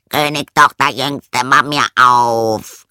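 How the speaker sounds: loud frog call, last word drawn out. A narrator's reading. Frog at the princess's door, loud drawn-out calling. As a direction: call through a closed door, stretching the end. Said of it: "klopfte es an die Tür und rief".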